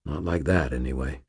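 plain reading voice

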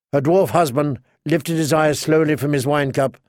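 ordinary delivery, nothing marked

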